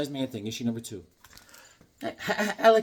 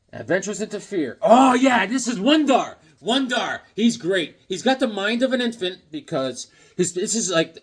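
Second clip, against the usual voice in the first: ordinary talk, nothing marked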